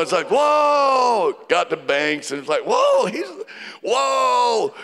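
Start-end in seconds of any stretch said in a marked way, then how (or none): 0.3-1.4 shouted cheer a little higher and louder; crowd cheer. Retold or imitated. Imitating crowd cheering contestant. 2.7-4.7 much higher, whooping mock cheer; wild crowd whoop. Imitating crowd cheering for Banks.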